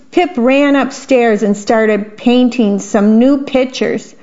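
ordinary delivery, nothing marked

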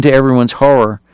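ordinary delivery, nothing marked

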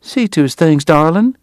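high-voiced wife giving orders; bossy but affectionate Southern wife; sweetly ordering. A narrator's reading. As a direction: order the husband about in a high lady's voice. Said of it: "she ordered".

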